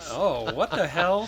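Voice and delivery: high, loud, drawn-out disbelief; incredulous. Real, not acted. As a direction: blurt it out in stunned disbelief. Stunned by absurd plot news.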